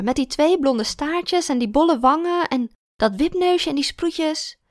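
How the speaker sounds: put-on the drunk old teacher thrilled to remember; tipsy old teacher. Kindergarten teacher voiced.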